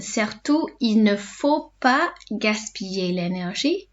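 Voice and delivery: slow, careful dictation pace; each word laid out for learners; slow teacher repeat; said slowly. Shown as a demonstration. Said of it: "say them slowly in between".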